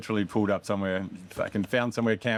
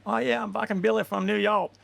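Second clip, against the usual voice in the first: put-on drunk American bro; 13 semitones higher than usual. Drunk American answers the camera.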